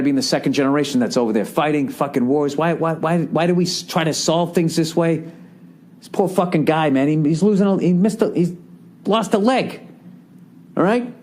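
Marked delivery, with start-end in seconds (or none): none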